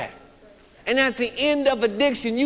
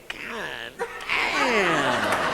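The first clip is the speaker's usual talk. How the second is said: dropped low, a groaning God damn; low dismayed curse; 9 semitones lower, 4 dB quieter than usual. Retold or imitated. Addict looking round the room, low groaning curse.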